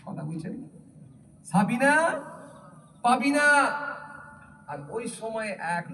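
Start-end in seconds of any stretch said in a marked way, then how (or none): 1.5-3.5 calling out names. Retold or imitated. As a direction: call the girls from afar. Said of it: "ডাকতে শুরু করেছে". (জোরে ডাকা)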